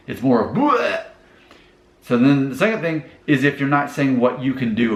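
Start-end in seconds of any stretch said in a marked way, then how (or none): 0.5-1.0 a high, sudden "bleh" of dumping feelings; a quick, high mock-vomit "bleh" at a coworker; mock emotional "bleh". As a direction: spit out a messy little "bleh" in a mock emotional outburst. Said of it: "vomiting that on the person".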